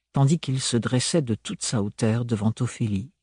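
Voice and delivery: plain reading voice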